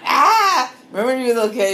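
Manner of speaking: high, loud laughing outburst. Real, not acted. Recalling a childhood tape with friends, laughing high. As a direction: burst out laughing into the memory.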